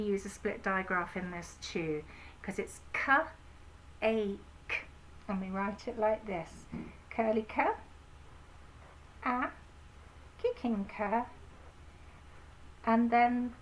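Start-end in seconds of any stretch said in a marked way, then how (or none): none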